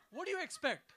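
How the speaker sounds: usual speaking voice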